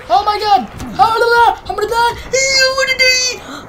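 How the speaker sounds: high, loud, frantic gamer panic; panicked screaming. Real, not acted. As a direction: escalate to a wailing yell, stretching the end. About to die in game.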